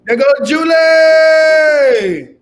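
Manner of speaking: loud, slow, drawn-out call; excited shout. Real, not acted. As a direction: call out her arrival excitedly.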